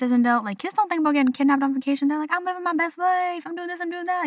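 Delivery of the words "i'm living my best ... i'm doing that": high-pitched mock kid voice; carefree kid. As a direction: sing it out like a blissfully carefree child. Acting out carefree kids on vacation.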